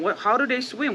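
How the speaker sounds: ordinary delivery, nothing marked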